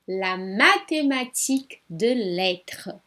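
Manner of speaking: slow, careful French "la mathématique de lettres"; "la mathématique de lettres" said slowly in French; French model phrase. Shown as a demonstration. Teacher models "la mathématique de lettres" slowly in French. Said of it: "A matemática das letras".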